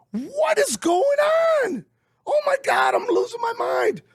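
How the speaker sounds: very high, shouted, losing it; ecstatic shout. Retold or imitated. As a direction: scream with joy like you can't believe it. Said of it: "And I'm like".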